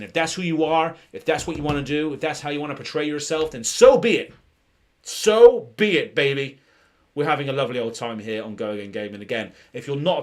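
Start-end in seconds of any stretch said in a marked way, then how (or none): 3.6-6.6 loud, high, emphatic proclamation; rousing declaration. Pretend. Streamer cheering viewers on to be themselves, rousing declaration. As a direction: build to a big, drawn-out declaration.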